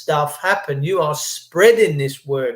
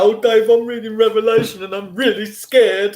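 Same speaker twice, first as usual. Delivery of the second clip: mock scared; 6 semitones higher, 3 dB louder than usual. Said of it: "People get scared".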